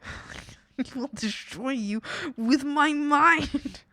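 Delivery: slow, drawn-out mock menace, laughing; quoting her mock threat. Streamer replays her viral clip, slow mock menace. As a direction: replay the clip's villain line. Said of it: "that has been stuck in my head".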